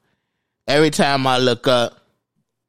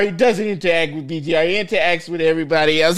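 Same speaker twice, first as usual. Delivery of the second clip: ordinary delivery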